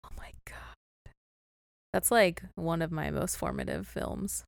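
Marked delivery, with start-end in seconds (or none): 0.0-1.1 shocked whisper. Real, not acted. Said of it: "shocked at friend not seeing film".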